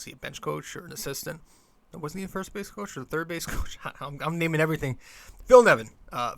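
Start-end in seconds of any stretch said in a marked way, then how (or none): 5.5-5.9 sudden recall. Real, not acted. Suddenly remembers the name.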